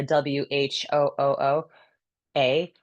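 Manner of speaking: fog the window breath; throaty whooo from the back; breathy whoooa. Shown as a demonstration. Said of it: "like you're fogging up a window".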